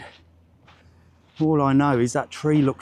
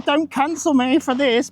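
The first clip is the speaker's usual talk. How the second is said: higher, slower, hammed-up plea; jokey higher-pitched appeal; mock pleading; 11 semitones higher than usual. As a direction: beg playfully not to be cancelled. Mock plea after joke.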